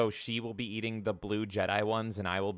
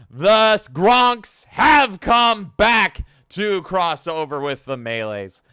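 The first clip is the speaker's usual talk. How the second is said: slow, high, bellowed hype-man proclamation; mock-epic announcement; 8 semitones higher, 12 dB louder, much slower than usual. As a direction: belt it out word by word, easing off at the end.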